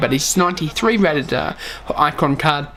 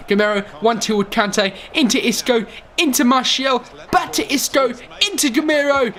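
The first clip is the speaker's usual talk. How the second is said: commentary building toward the goal; rising excitement; 8 semitones higher than usual. Real, not acted. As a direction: pitch climbs higher pass by pass.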